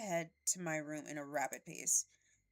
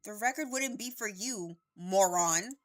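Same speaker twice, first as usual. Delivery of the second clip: angry; 4 semitones higher, 9 dB louder, slower than usual. Acted. Angry retort in a fight.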